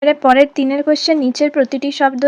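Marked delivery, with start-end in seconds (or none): none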